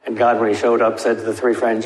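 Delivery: ordinary talk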